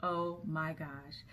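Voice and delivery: hushed, slow retold realization; dawning shock. Woman realizes who she just greeted, quiet dawning shock. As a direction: let it sink in slowly and softly. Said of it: "when it hit her".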